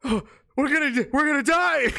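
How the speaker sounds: high, loud mock panic; panicked. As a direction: cry out that doom is coming. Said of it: "they're like".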